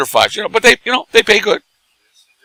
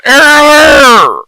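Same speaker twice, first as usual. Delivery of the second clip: very high, loud royal trill on "Air, hair, lair"; like the Queen; 9 dB louder, much slower than usual. Shown as a demonstration. Teaching the podcast host a royal hello, high mock-queen trill. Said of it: "wave your hand like the Queen".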